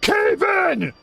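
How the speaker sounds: high-pitched urgent yell; alarm shout. Acted. Camp boss warning everyone after the rumble, alarmed shout.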